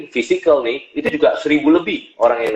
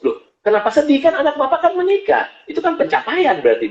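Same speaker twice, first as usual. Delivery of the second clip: much higher, incredulous retold question; puzzled surprise. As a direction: replay your own surprised reassurance, light. Coach puzzled by sad father.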